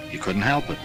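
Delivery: ordinary talk, nothing marked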